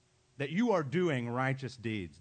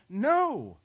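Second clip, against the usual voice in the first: loud, high, firm refusal; emphatic prohibition; 5 semitones higher, 7 dB louder than usual. Acted. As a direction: voice a forceful command to stop. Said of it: "Jesus is saying".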